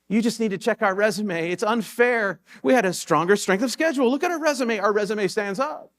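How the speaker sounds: hammed-up yelling coach defending his record; mock coach yelling. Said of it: "yelling at the news people".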